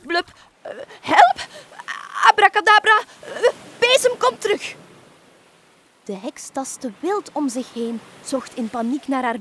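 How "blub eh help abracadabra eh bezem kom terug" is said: very high, loud spluttering panic; the witch splutters in the water, calling her broom back; panicked cries. A narrator's reading. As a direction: gasp and flail for the lost broom. Witch falls into the sea.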